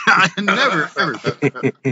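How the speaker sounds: laughing through the denial. Real, not acted. Repeating his denial to the hosts, laughing. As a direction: break into laughter as you repeat it.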